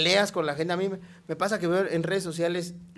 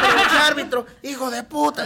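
mock stadium yell; 9 semitones higher, 6 dB louder than usual. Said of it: "stadium yell".

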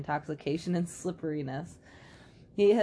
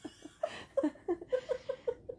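high breathy laugh; 5 dB quieter than usual. Real, not acted. Laughing at the story end.

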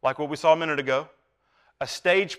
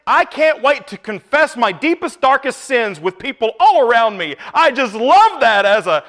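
sarcastic; 6 semitones higher, 7 dB louder than usual. Retold or imitated. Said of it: "wake up in the morning and say".

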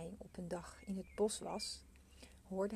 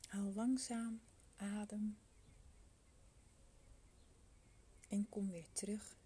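soft spa whisper; 3 dB quieter than usual. Acted. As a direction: guide them gently into calm. Leads a meditation.

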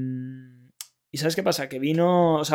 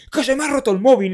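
retold angry yell, much higher and louder; angry young man; 9 semitones higher, 6 dB louder than usual. Player complaining to the laser tag worker, retold angry yell. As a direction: bark it like an outraged player. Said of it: "hiper mega enfadado".

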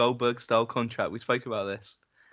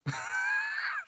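high wheezing laugh; 8 dB quieter than usual. Real, not acted.

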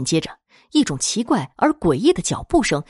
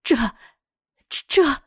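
halting, high-pitched stammer; falter, lost for an answer; flustered stammering; 7 semitones higher than usual. A narrator's reading. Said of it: "大老婆支吾着说不出话来".

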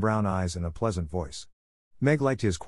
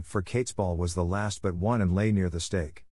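ordinary delivery

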